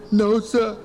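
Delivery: acted weepy child; crying kid. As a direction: answer meekly through put-on sobs. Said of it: "He was crying".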